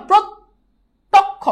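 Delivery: "প্রত্যক্ষ" high and slow, as prot, pause, tok-kho; ভাঙা উচ্চারণ. Shown as a demonstration. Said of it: "উচ্চারণটা যখন লিখতেছি". ("প্রত্যক্ষ" ধীরে, প্রোত্-তোক্-খো ভেঙে)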